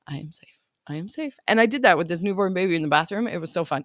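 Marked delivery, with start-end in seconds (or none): 0.1-0.6 whispered mantra. Shown as a demonstration. Mom soothing her nervous system, whispered mantra. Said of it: "reprogram myself that I am safe". 0.9-1.4 voiced but soft, a little higher; soft mantra. Shown as a demonstration. Mom repeating her calming mantra, soft and voiced. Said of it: "reprogram myself that I am safe".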